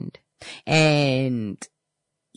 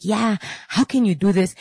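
mild mock yell, pitched up; retold yelling; 6 semitones higher than usual. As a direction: imitate a yelling client without full volume. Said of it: "She's yelling at the top of, of her, her lungs".

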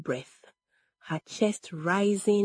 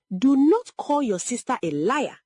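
high, raised-voice scolding; shouted rebuke; 6 semitones higher, 4 dB louder than usual. A narrator's reading. Mother rebukes daughter after slapping her, shouted. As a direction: scold with pitch lifted. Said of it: "Egodi shouted".

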